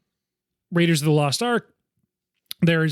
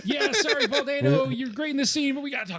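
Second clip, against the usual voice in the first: laughing; 10 semitones higher, 5 dB quieter than usual. Real, not acted. Laughing apology to actor.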